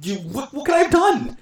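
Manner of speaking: high squeaky comic whine; exasperated goalie. Retold or imitated. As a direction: pitch jumps very high in helpless protest. Goalie answers blaming defense.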